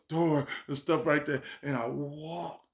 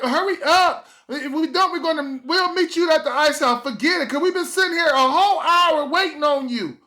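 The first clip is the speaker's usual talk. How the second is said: much higher, louder fed-up mom; impatient mom; 12 semitones higher, 9 dB louder than usual. Retold or imitated. A mom telling her late son to hurry, much higher and louder. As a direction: push it like a mom done waiting.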